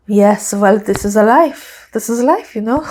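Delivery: imitated French accent, phrases lilting upward; mock French accent with a verbal shrug. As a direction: put on a philosophical French team boss. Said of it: "He's so French".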